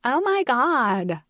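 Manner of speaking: put-on high, drawn-out Valley Girl exclamation; Valley Girl accent. A narrator's reading. Mom teasing her excited daughter, Valley Girl accent. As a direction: gush like a Valley Girl, stretching it slowly. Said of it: "exclaimed in my best Valley Girl accent".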